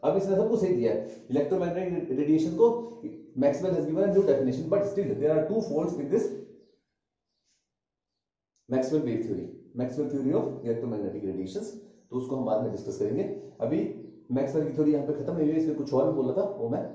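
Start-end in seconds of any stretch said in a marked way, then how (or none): 3.4-11.7 slow, word-by-word dictation pace; teacher dictating notes slowly. Shown as a demonstration. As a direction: spell it out slowly so students can write.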